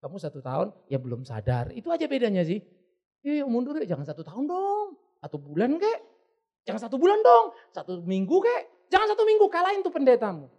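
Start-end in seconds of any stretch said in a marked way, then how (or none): 3.2-10.5 coax, then push higher and louder each round; cheeky bargaining that builds to a loud challenge; escalating playful haggling. Acted. (menawar dengan nada makin tinggi)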